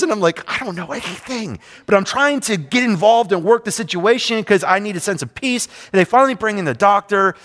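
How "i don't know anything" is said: much softer, under-the-breath aside; hushed admission. Retold or imitated. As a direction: drop to a hushed, confessing voice. Acted aside while meddling with nurses.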